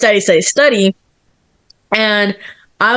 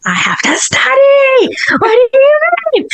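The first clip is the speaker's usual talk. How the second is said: high, pitched-up disbelief at friends; incredulous high protest. Retold or imitated. To friends wanting to hang out, high incredulous protest. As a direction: squeak out a stressed protest.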